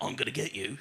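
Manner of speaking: low murmured taunt; creepy low threat. Retold or imitated. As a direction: creep it out quietly. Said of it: "They're like".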